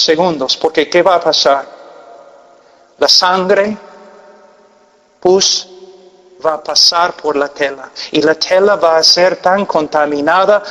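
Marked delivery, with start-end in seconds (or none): none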